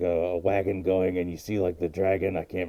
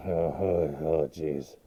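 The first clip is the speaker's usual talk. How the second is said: retold dismay; 3 semitones lower, much slower than usual. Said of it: "I'm like".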